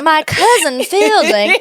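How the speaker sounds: high, slow hammed-up Southern voice; exaggerated old Southern accent, half laughing; old Southern drawl. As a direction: stretch it out in a syrupy Southern drawl. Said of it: "like, old Southern".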